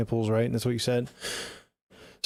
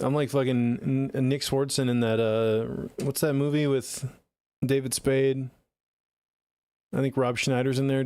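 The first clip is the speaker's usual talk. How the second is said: ordinary talk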